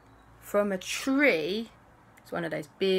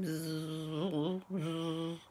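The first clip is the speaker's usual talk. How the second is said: soft buzzing; 11 dB quieter, much slower than usual. Acted. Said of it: "the bees were buzzing around going".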